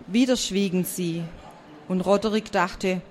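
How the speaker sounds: ordinary delivery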